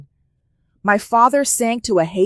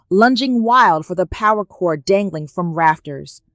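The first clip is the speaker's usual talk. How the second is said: plain reading voice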